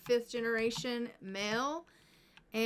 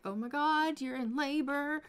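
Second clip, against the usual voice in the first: higher-pitched mock panic; freaking out; 5 semitones higher, 3 dB louder than usual. Father-to-be seeing his wife in labor, mock freak-out. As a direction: play a panicking father-to-be, hammed-up. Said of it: "Robert's freaking out".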